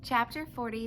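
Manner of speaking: plain reading voice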